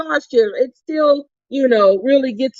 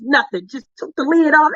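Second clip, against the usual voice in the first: normal talking voice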